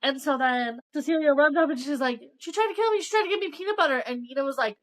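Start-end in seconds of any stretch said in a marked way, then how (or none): 2.4-4.0 much higher-pitched imitation of an upset child; mock a kid running up to tattle; put-on high, urgent complaint; retold panicked tattling.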